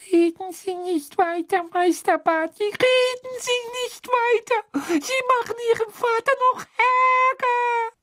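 squeaky high elf voice, near tears; frantic tiny elf pleading shrilly; trembling house-elf. Acted. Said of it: "Die zitternde Winky schüttelte den Kopf".

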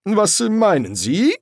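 high, drawn-out innocent question; guilty. A narrator's reading. Angel caught making light asks back, guiltily. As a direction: play innocent with a guilty conscience. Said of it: "fragte Erziraphael schuldbewusst".

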